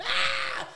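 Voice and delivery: short high imitated shriek; mock scream. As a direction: squeal it like a scared passenger. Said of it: "I'm screaming".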